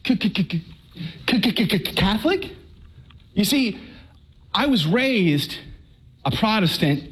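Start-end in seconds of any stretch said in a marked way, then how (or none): 0.1-2.5 mock stutter ending in a rising question; stammering shock. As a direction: stammer it out in comic panic. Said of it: "And I went".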